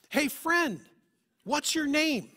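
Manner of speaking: put-on loud, high child's call across the aisle; kid yelling out. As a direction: shout it like a five-year-old calling a new friend. Kid yelling in grocery store.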